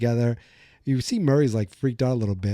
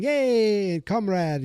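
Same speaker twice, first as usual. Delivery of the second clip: put-on high, drawn-out hail to an old pal; smiling fake greeting; 9 semitones higher, much slower than usual. Impostor greeting the prison warden, imitated with a smile. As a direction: put on a big smile and sing out the hello. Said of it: "puts on this whole, like, smiling".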